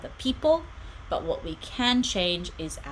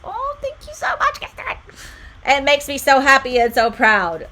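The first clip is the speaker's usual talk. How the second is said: excited thanks; 7 semitones higher, 11 dB louder than usual. Real, not acted. Viewer praises her deck.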